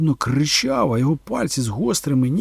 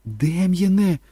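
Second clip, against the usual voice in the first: higher-pitched cry to her son; shout; 7 semitones higher, 3 dB louder, much slower than usual. A narrator's reading. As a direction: call out to the boy in alarm. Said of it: "закричала Кетрін". (стривожений окрик)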